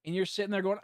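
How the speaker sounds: ordinary talk, nothing marked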